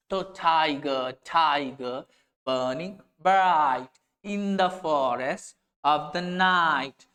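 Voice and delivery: tiger, bright and night with the ai sound slowed and drawn out; stretching the ai vowel. Shown as a demonstration. Teacher rereads the verse to show the repeated ai sound, drawn out. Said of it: "फिर से वही sound आई".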